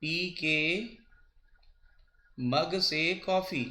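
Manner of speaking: spaced out word by word as if writing on the board; slow dictation. Shown as a demonstration. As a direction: dictate slowly with pauses. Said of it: "इसको हिंदी में भी लिख देता हूँ".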